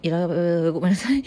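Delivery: usual speaking voice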